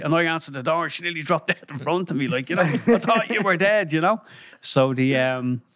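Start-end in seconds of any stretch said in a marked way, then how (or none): 2.6-3.9 slow, high, startled disbelief; as if she has seen a ghost; startled gasp. Retold or imitated. A friend shocked at the door.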